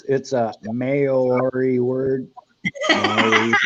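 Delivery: giggling through the words; laughing while reading it out. Real, not acted.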